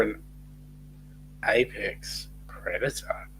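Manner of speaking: low, soft and slow, imitating a menacing purr; ominous tone. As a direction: drop the voice low and quiet, draw out apex predator like a warning. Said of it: "she said it in that tone of voice".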